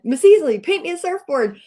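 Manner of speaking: put-on loud begging kid. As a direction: call it out like the hundred-and-fiftieth eager student. Hundred and fiftieth kid begs.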